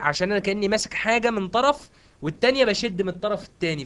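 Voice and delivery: ordinary talk, nothing marked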